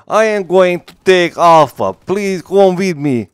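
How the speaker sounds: slow, high, hammed-up broken Russian accent; mocking game soldiers with a broken Russian accent. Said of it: "with the broken Russian accent".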